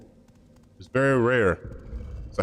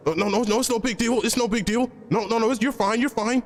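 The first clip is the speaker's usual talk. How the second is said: much higher, fussy voice brushing it all off; mock placating voice; 11 semitones higher than usual. Mimicking an overexcited friend telling him it's fine, high and fussy. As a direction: imitate someone insisting it's no big deal, high and fussy. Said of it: "they be like".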